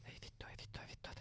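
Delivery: soft, low whispered swearing; whispered dread. Retold or imitated. Leaving the hospital hungover, whispered despair.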